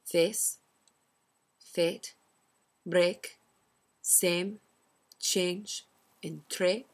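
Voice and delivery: the words read out in a Scottish accent; Scottish accent demo words. Shown as a demonstration. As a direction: demo each word the Scottish way. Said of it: "For example".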